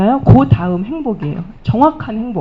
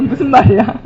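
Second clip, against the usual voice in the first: baffled question; 2 semitones higher, 7 dB louder than usual. Retold or imitated. Coworkers baffled by her remark.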